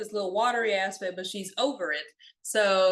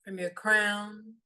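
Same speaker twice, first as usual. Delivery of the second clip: softer meditation voice with long pauses; guided meditation; 3 dB quieter than usual. Real, not acted. Guide leading listeners through a body scan, calm meditation voice. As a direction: guide them gently inward, leaving long silences.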